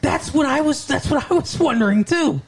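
high-pitched, eager agreement; excited agreement. Real, not acted. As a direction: jump in, pitch way up. Eagerly agreeing with the same question.

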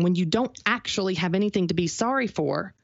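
normal talking voice

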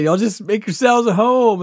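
high, loud retold host call; calling out welcome. Host tells arriving guests to settle in, calling out. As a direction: throw it out to the whole crowd.

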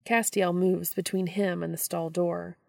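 usual speaking voice

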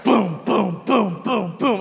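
punchy, repeated mock booms; imitated punch noises. Comedian acting out being used as punching bag, punchy booms. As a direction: sound out each blow landing.

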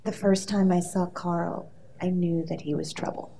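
quiet line reading. Shown as a demonstration. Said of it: "I wanna give you a piece of dialogue".

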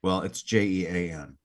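normal talking voice

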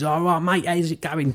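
put-on high-pitched matey Essex-East Anglian accent; brother's local accent. Imitating brother for the host, matey local English accent. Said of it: "type vibe".

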